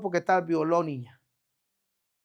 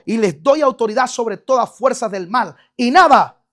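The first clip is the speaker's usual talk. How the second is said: loud proclamation; 8 dB louder than usual. Real, not acted. Preacher proclaiming scripture.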